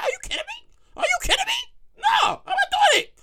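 very high, strained outrage, slowing into a shouted no; shrill refusal. Pretend. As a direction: shriek it out, flat refusal. Refusing to lend money.

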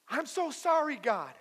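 high-pitched, insistent mock plea; acted pleading apology. Believer apologizing to God, acted pleading. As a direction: imitate someone apologizing over and over.

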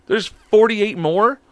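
mock disbelief. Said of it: "And I'm like".

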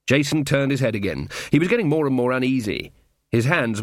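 ordinary delivery, nothing marked

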